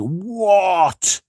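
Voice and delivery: high, startled "What?"; a squeaked, stunned "What?"; shocked what. Retold or imitated. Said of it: "I made, like, a Jeremy noise".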